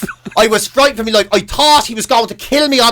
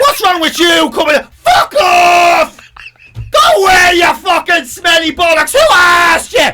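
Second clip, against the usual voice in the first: mock furious shout; 7 semitones higher, slower than usual. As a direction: scream abuse at the lad. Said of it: "you go".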